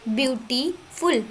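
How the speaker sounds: ब्यूटीफुल broken as "beauty ... ful"; split into syllables. Shown as a demonstration. Teacher showing two parts, ब्यूटीफुल split as beauty ... ful. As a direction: pause inside ब्यूटीफुल between beauty and a separate ful. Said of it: "तोड़ना पड़ता है बोलने के लिए".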